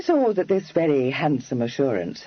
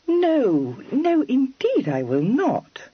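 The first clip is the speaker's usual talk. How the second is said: higher, slow coaxing; 12 semitones higher, slower than usual. A narrator's reading. Kitty coaxing anxious Freddy, slow and high. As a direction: coax him gently, drawing it out. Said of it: "Kitty said coaxingly".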